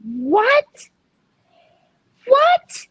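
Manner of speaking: mock shriek of disbelief; retold shock. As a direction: act out stunned confusion on the phone. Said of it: "I was like".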